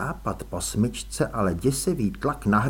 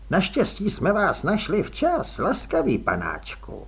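woman's voice; 6 semitones higher than usual. A narrator's reading. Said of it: "ozval se za ním ženský hlas".

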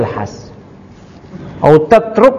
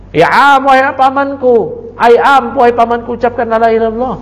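higher, earnest plea to a dying uncle; pleading with uncle; 8 semitones higher than usual. Retold or imitated. Nephew urges his dying uncle, lightly pleading. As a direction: coax him gently toward the words. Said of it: "Udah ditalkin di penghujung umurnya".

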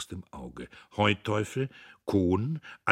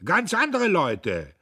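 loud, high, slowed at first; loud, high righteous harangue; bellowing speech; 9 semitones higher, 11 dB louder than usual. A narrator's reading. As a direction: roar down the hall with accusations. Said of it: "und er brüllte".

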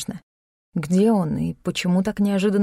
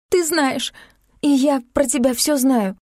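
high-pitched, worked-up voice; agitated accusation; 6 semitones higher, slower than usual. A narrator's reading. Heroine tells Klim she knows everything, agitated. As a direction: press the accusation, close to hysteria. Hysterical accusation over the phone.

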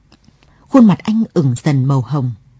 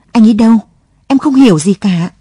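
raised, louder anxious questioning; baffled and alarmed, voice lifted; bewildered; 4 semitones higher, 6 dB louder than usual. A narrator's reading. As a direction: demand it in confusion. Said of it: "Lụa ngơ ngác".

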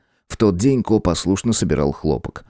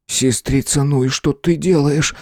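surprised; 6 semitones higher, slower than usual. A narrator's reading. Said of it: "удивился мальчик".

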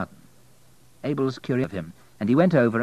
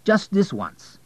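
high, urgent plea; eager, higher-pitched appeal; pleading; 10 semitones higher, 4 dB louder, slower than usual. Acted. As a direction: coax insistently, pitch raised. Abel pleads for a dinner date.

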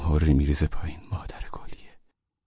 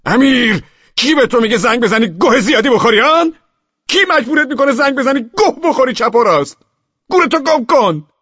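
furious roar, much higher and louder; screaming in rage at a friend on the phone; 11 dB louder than usual. Acted. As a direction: roar down the phone to drive him off.

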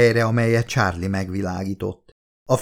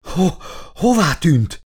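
winded from running, slow and higher; breathless, halting higher voice; panting, exhausted; 7 semitones higher, slower than usual. A narrator's reading. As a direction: gasp out the question, worn out from the run. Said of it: "zihálta Elizabeth a futástól elgyötörten".

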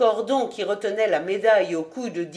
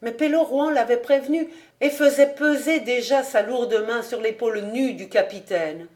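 ordinary delivery, nothing marked

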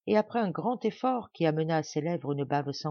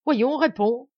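loud, higher insistence; insistent demand; 7 semitones higher, 9 dB louder, slower than usual. A narrator's reading. Madame Blanche pressing Marianne for an answer, loud and higher. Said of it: "insista-t-elle".

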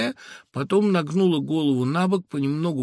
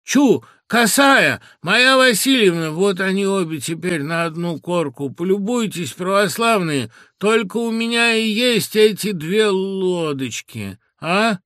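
put-on loud, higher, laboured babble of an old man; косноязычный лепет; 6 semitones higher, 7 dB louder than usual. A narrator's reading. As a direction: strain loudly to be understood, dragging words out. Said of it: "говорил не то, не ладно, и делал страшные усилия". (косноязычная речь с натугой, громко)

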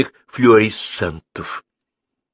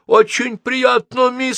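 put-on high, slow, friendly old man; a kindly old man greeting her, drawn out; high-pitched cheerful elderly man; 13 semitones higher, 4 dB louder, slower than usual. A narrator's reading. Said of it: "приветливый старческий голос".

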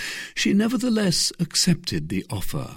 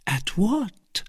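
innocent question; 11 semitones higher than usual. A narrator's reading. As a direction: feign wide-eyed innocence, higher. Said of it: "with the utmost ingenuousness".

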